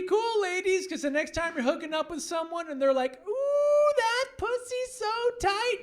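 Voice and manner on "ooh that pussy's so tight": high, slow, breathy-sounding moan of a hookup partner; mock moaning lover. Guy mid-hookup, hammed-up high swooning moan.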